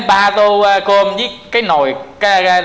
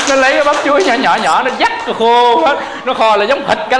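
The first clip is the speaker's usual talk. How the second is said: higher-pitched, amused telling through laughter; laughing while talking; 3 semitones higher than usual. Real, not acted. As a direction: keep telling it through real giggles. Laughing through a funny story.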